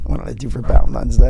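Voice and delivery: low-pitched, mischievous echo of the question. Real, not acted. Podcast guest asked about Valentine's Day, answering mischievously. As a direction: repeat the question slyly, with a laugh. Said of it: "You said that so mischievously".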